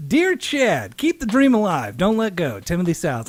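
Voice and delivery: high, loud, hammed-up inspirational sign-off; corny autograph message. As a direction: ham up a cheesy rock-star dedication. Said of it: "I'd go".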